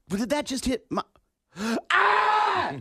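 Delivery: rising panic, then a sharp high gasp; sudden realization of a groin hit, gasping; just hit in the groin. Retold or imitated. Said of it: "Guys will go".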